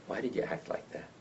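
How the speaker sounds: soft whisper to the dog. Retold or imitated. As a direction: whisper the question gently. Asking his dog gently.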